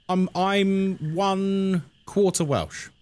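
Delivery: ordinary talk, nothing marked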